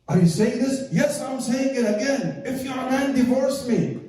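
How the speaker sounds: high-pitched, insistent challenge; heated quarrel. Acted. Wife dares her husband to divorce her, defiant. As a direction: throw the dare back in an acted row.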